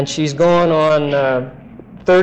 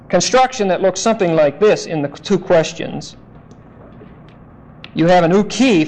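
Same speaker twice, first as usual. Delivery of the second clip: ordinary talk, nothing marked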